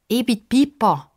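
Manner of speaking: put-on girl introduces herself; quickly. A narrator's reading. Pippa introduces herself to old man, quick girl voice. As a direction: introduce yourself. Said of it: "sagt sie schnell".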